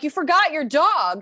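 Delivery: loud, high, drawn-out alarmed call; mock-alarmed shout to people walking off; calling after someone. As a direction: call out after departing strangers, high and stretched. Calling after people leaving picnic.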